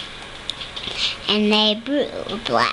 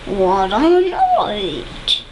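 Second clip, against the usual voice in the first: mole mutter; slower than usual. Acted. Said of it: "Mole mutters".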